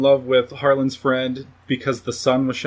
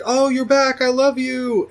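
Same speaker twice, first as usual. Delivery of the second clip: much higher, squealing delight; gushing excited girlfriend; 11 semitones higher than usual. Retold or imitated. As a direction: throw the voice way up in giddy joy. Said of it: "Kelsey's like".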